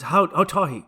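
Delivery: lightly imitated Chinese accent. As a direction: slip into a mock Chinese accent. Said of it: "he just did a Chinese accent".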